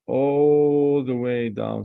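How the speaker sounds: "all" stretched very long in "all the way down"; slow, sustained "all", then finish the phrase; drawn-out emphasis. Real, not acted. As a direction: hold the vowel of "all" as the stroke continues. Drawing a long line while teaching.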